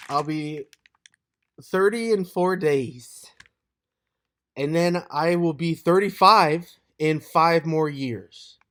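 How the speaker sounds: loud, drawn-out proclamation; slow and high, belting out each phrase; loud announcement. Real, not acted. Vlogger announcing his birthday to viewers, loud and drawn-out.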